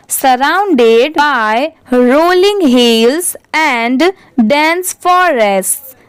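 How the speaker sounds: very slow, word-by-word read-aloud; careful model reading. Shown as a demonstration. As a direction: read slowly and distinctly for learners, drawing out each word. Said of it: "अच्छे से read करते". (बहुत धीरे, हर शब्द अलग-अलग पढ़ते हुए)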